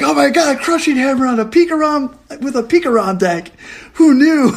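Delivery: high, loud announcer hype; losing their minds over the play; excited announcer. Retold or imitated. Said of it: "the announcers were losing their minds".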